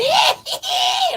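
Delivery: high, shrieking witch cackle. Retold or imitated. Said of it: "they sound like a damn witch".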